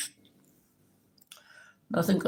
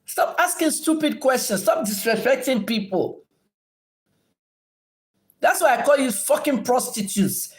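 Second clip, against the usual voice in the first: high-pitched, exasperated telling-off; angry scolding; 8 semitones higher than usual. Real, not acted. Streamer orders viewers to stop disrespecting people, angry. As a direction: scold them sharply, pitch jumping up. Scolding a rude commenter live.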